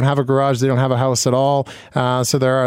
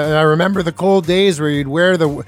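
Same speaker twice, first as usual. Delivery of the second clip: usual speaking voice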